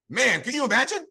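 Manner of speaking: ordinary talk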